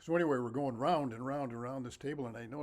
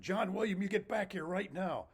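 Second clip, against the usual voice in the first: nun calling him back, raised and higher; mock yell; 6 semitones higher than usual. A nun yelling after a runaway boy, raised voice. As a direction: bark his name up high after him.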